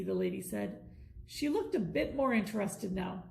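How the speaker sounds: ordinary delivery, nothing marked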